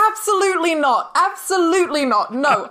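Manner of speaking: high, insistent double no; emphatic denial. Real, not acted. To the host asking about a feud, high emphatic denial. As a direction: shoot the idea down eagerly, twice. Denying a feud with Big Finish.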